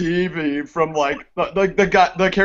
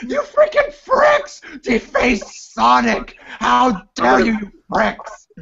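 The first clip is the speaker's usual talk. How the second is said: higher, slow mock rant at the fans; shrill-free hammed-up outrage, drawn out; mock outraged Sonic fan; 4 semitones higher than usual. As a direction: ham up an indignant fan yelling at vandals. Mocking an angry Sonic fan.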